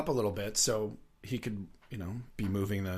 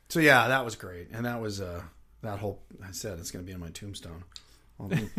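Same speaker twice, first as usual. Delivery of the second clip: normal talking voice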